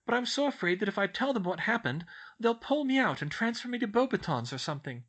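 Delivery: put-on high, earnest girlish outpouring; spilling out. A narrator's reading. Schoolgirl unburdens herself to her professor, words spilling out. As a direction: pour out the worries without holding back. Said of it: "the words came spilling out".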